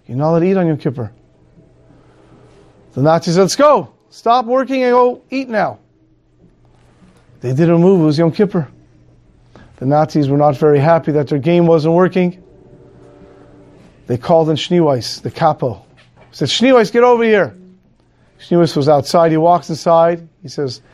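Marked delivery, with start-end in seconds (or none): 3.4-5.8 higher-pitched mocking command; barking Nazi officer. 16.5-17.5 higher, sharp call across the room; barking Nazi summons. Acted. Nazi officer calling the capo inside, sharp higher order.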